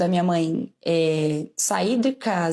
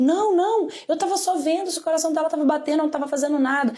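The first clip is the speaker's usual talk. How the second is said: high, fast flustered denial; caught uncle's excuse; 7 semitones higher than usual. Retold or imitated. Uncle caught by the parents pleads innocence, high and rushed. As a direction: babble out a nervous excuse.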